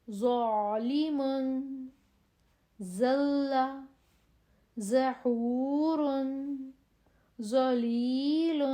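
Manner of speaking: slow heavy ظ drill; thick zoe drill. Shown as a demonstration. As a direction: thicken each ظ. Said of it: "زو".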